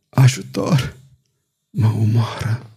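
breathless dying whisper; murmured whisper. A narrator's reading. Wounded duke whispering for help. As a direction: barely get the words out, whispering. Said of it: "izbuti să murmure".

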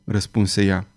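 plain reading voice

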